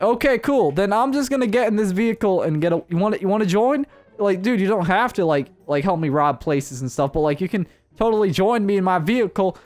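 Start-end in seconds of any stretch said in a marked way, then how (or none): none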